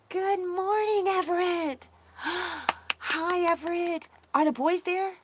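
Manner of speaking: high, delighted sing-out with a gasp; excited greeting. Real, not acted. Streamer greeting Everett as he joins, excited. Greeting family arriving in chat.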